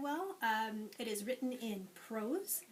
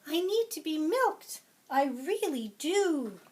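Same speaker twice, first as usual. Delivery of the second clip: higher, louder pleading cow; 6 semitones higher, 10 dB louder than usual. Acted. Teacher voicing the cow for the class, higher and louder. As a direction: beg to be milked, voicing the cow. Cow character quote in story.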